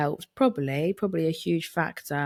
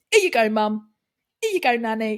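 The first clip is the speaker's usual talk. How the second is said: playful call; 7 semitones higher, 6 dB louder than usual. Pretend. Family imitating throwing loaf.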